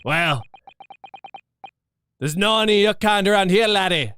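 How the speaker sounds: loud, high Scottish governor brushing him off. Acted. Governor brushing off the lawyer, in a loud Scottish accent. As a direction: send the laddie away in Scots.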